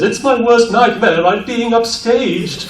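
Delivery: high, slow theatrical Pegasus; hammed-up dramatic villain lament; Pegasus quote. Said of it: "he says".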